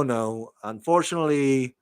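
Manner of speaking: normal talking voice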